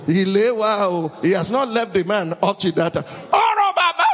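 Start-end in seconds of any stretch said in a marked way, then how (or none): 3.3-4.0 mock cry of pain. Sick elder crying out at the injection, high comic yelp. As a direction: yelp like the injection just hit. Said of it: "how can someone ... cry, "Oro"?".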